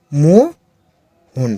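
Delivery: Mohan stretched very slowly into মো and হন; a teacher spelling out Mohan slowly for pupils; slow syllable by syllable. Shown as a demonstration. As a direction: draw Mohan out slowly, sound by sound. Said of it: "উচ্চারণটা যেভাবে হবে".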